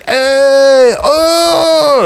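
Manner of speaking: loud, high party yell; rowdy shout. Retold or imitated. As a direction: whoop like rowdy kids in a mosh pit. Imitating moshing kids yelling.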